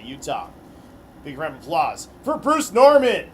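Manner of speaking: arena driver introductions; announcer. Acted. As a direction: hype each name for the crowd.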